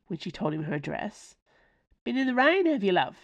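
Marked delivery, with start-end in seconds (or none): 2.0-3.2 higher, friendly working-class cabby; a cheeky driver ribbing his fare; chirpy cabby. Acted. Cabby teases his passenger.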